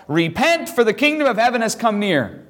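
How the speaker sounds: half-hearted prophetic scream; mild mock scream. John the Baptist calling people to repent, mildly mock-screaming. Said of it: "John the Baptist screams out".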